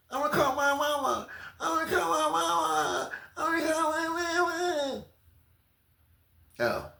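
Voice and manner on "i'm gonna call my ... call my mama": high sobbing whine; high crying wail; mock crying for mama. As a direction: blubber it like a big baby. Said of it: "It's always mama".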